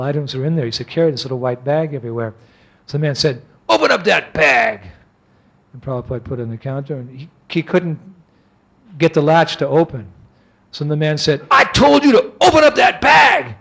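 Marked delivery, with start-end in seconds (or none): 3.7-4.8 slow, firm order from a mean official; lightly voiced burly man repeating his demand; a touch of grumpy authority, words drawn out; grouchy customs officer. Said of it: "he was a grouchy big man". 11.5-13.5 high, loud imitation of an irate customs man; hammed-up furious order, pitch way up; angry official's shout. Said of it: "he was a grouchy big man".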